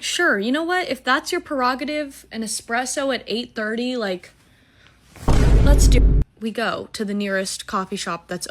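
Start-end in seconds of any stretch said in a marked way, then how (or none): 0.0-6.2 higher, mock-sweet sarcasm; sarcastic agreement. She agrees to late coffee with Teddy, sarcastic. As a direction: agree while rolling your eyes. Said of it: "I'm like".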